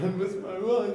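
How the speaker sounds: high, loud hammed-up crying wail; mock sobbing wail. Crying to his wife on the phone, hammed-up wail. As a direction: blubber it like a kid, over the top. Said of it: "and I was just crying".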